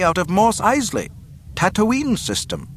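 high, formal report, falling endings; reporting over speaker. A narrator's reading. Officer reporting the ship's origin, high formal announcement. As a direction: announce place names high and matter-of-fact. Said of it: "a voice reported over an unseen speaker".

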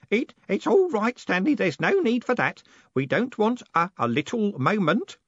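put-on high, halting old man; nervous soothing. A narrator's reading.

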